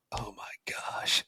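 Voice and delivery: slow, breathy whisper of shock; mock horrified whisper after bad feedback; whispered dismay. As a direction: whisper it like you can't believe it. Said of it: "And I would go".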